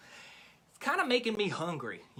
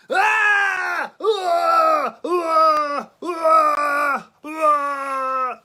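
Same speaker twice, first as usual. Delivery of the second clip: repeated hammed-up shrieks, much higher and louder; mock screams; 8 semitones higher, 9 dB louder, much slower than usual. Host faking fright at the pet rat, hammed-up screams. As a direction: fake horror screaming. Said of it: "Just kidding, just kidding".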